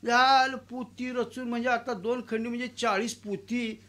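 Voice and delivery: ordinary talk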